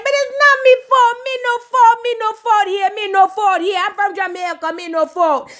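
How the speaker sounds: high, insistent Jamaican patois denial; Jamaican accent. Retold or imitated. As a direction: plead no fault in a Jamaican accent. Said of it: "Spice was like".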